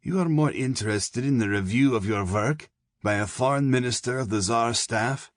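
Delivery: a sly German officer warning the prisoner; Fritsch, German colonel. A narrator's reading.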